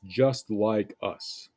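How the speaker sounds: "just like us" said slowly with weight on "just"; slow emphatic phrase. Shown as a demonstration. Teacher pointing out the short phrase, slow and emphatic. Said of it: "that short phrase for emphasis".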